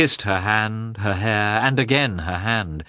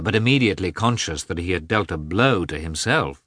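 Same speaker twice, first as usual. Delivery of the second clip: plain reading voice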